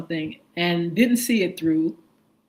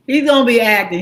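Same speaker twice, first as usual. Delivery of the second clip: loud, punchy repeat; loud emphatic; 3 semitones higher, 10 dB louder, faster than usual. Shown as a demonstration. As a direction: belt it out with emphasis. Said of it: "Did y'all hear what I said?".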